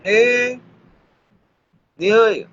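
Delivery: high, held-out call; high-pitched hail. Real, not acted. Calling someone, long pauses between.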